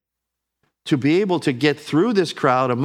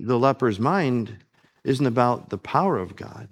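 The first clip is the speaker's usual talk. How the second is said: ordinary delivery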